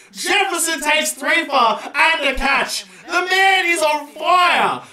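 high, slow, proud bellow; excited announcer shout. Real, not acted. Gamer proclaims his bowler's haul in a big excited shout. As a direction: call it like a stadium announcer.

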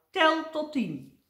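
slow, high, loud instruction; emphatic advice. A narrator's reading. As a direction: spell it out slowly so it sticks. Tramp stresses his parting advice.

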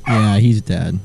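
normal talking voice

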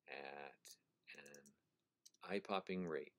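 slow breathy whisper, dropping away; soft whisper. Shown as a demonstration. Teacher to class, rereading the quote in a slow whisper. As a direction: let the phrase fade to a slow whisper.

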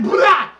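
loud sputtered "blah" of disgust; mock spitting out something foul; spitting noise. Man spitting into the sink, disgusted sputter. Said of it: "I spat in the sink".

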